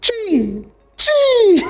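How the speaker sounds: high rising shriek of disbelief; shocked exclamation. Real, not acted. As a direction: react in loud disbelief. Shocked reaction to answer.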